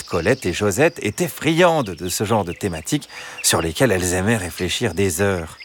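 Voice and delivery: ordinary delivery, nothing marked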